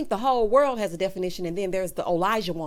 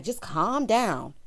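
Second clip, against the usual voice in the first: slow mocking quote; much slower than usual. Quoting Jasmina slowly.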